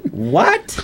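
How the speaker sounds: high, laughing disbelief; stunned what. Retold or imitated. Hearing the Tonight Show news, a high laughing "what". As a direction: yelp it out in disbelief.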